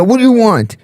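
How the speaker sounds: defiant retold demand, pitched high; confrontational challenge. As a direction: throw it at them like a challenge. Retelling the confrontation.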